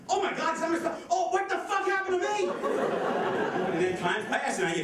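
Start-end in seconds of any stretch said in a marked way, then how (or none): 0.1-2.5 high-pitched comic panic; high, dismayed outburst; mock horror. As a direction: wail it as a hammed-up horrified realization. Acts horrified realization about spouse.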